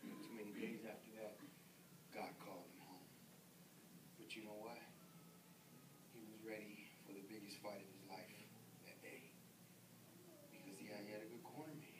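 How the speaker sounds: very soft and low, barely audible; preacher nearly whispering a sad ending; hushed. Real, not acted. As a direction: let the grief sit in near silence. Retelling the man's death.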